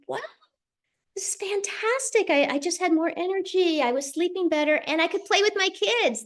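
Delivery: excited. Retold or imitated. Retelling her delight at feeling better.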